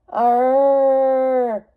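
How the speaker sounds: long growling beast roar; monster roar. Acted. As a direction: roar like a hidden beast in the dark. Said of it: "She froze at the sudden sound".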